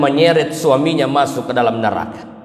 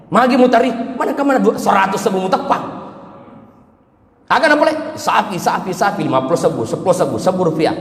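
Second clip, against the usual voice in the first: mock stingy husband; 5 semitones higher, faster than usual. Mocking husband's small donations.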